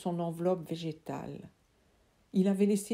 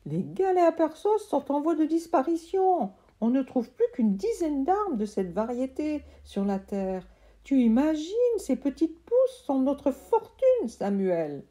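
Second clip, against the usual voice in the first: much higher, loud delighted voice; thrilled uncle; 7 semitones higher, 4 dB louder than usual. Acted. Uncle thrilled by his sprouting seeds, high and loud. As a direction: burst with delight.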